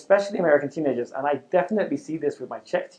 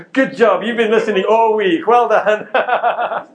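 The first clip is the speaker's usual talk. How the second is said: loud laughing praise, much higher; 7 semitones higher, 9 dB louder, slower than usual. Real, not acted. Speaker praising attentive audience, laughing loudly. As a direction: congratulate them through real laughter. Audience gives the expected answer.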